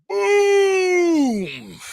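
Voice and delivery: sudden high shouted boom; crash shout. Retold or imitated. Telling a friend about the crash, shouting the impact. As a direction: belt out the crash like it's happening again. Crash moment in accident story.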